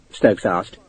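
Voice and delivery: usual speaking voice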